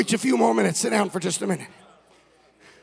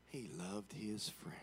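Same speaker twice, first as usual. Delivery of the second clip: mock sentimental whisper; 11 semitones lower, 30 dB quieter than usual. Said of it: "and they say".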